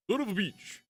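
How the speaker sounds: high, loud burst of frustration; exasperated curse. Real, not acted. Streamer struggling to fit a module, exasperated outburst. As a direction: curse sharply, pitch jumping up. Cursing while fitting a module.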